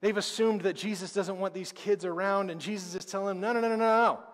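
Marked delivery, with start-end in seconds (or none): 3.4-4.2 louder, higher run of firm no's; emphatic no. Retold or imitated. Jesus correcting his disciples, a louder insistent no. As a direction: stop them short, insistent.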